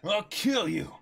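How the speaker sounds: loud shouted threat; furious shout. Acted. Usopp yells his threat at Klahadore, loud and furious.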